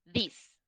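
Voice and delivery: "This" closed, dry and quick; short dry this. Shown as a demonstration. Teacher demonstrating a closed, dry "This". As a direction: clip "This" short and dry, no buzz. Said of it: "Es cerrado, es pausado".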